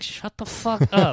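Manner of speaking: high-pitched imitated telling-off; mock exasperation. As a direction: play an impatient companion cutting someone off. Acting out Claudia silencing her companion.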